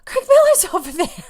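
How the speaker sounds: high, loud fangirl squeal; starstruck squeal. Retold or imitated. Telling of spotting Greg Miller nearby, starstruck squeal. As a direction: squeal it, re-enacting giddy disbelief. Excited fan retelling sighting.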